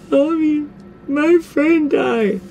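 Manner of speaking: high, slow mock-tearful wail; mock sobbing. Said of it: "I was like".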